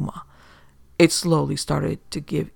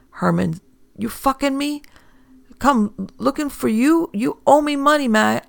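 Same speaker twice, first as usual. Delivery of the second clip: angry thug; 10 semitones higher than usual. A narrator's reading. Johnny threatening Herman.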